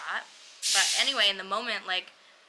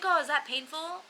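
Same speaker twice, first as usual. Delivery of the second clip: higher, innocent-sounding question; mock clueless doctor; 8 semitones higher than usual. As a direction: imitate an oblivious doctor, pitched up. Imitates the clueless doctor asking.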